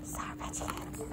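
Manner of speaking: soft unvoiced whisper. Real, not acted. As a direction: breathe the words out quietly. Whispering while unwrapping gifts.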